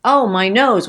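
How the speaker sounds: quoting Marcia from the Brady Bunch, a mock pained cry; mock Marcia wail. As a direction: quote the TV girl's cry, hammed up. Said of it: "Marcia gets hit and she says".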